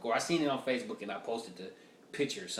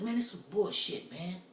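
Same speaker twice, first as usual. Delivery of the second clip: higher mock-offended rant; offended guy; 6 semitones higher than usual. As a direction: whine about the negativity like the offended guy. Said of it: "He's like".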